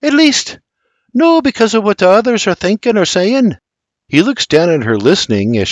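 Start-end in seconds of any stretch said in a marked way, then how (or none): 0.0-3.6 high, earnest Scottish voice; sighing Scottish plea. A narrator's reading. Mary tries to explain to William, high pleading Scots. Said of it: "She sighs".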